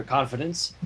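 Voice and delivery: normal talking voice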